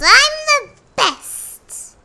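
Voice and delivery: high, drawn-out triumphant brag; loud boast. A narrator's reading. Rabbit crowning himself top of all beasts, loud boast. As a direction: proclaim it slowly at full volume. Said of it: "he boasted out loud".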